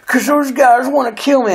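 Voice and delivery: high, frightened imitation of a panicked friend; scared plea. Friend begging for a ride away from attackers, mock scared high voice. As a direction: squeak it out like he is terrified. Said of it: "out of breath all of a sudden and scared".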